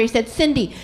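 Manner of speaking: normal talking voice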